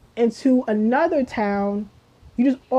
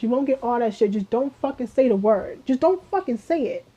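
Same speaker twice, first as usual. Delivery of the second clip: usual speaking voice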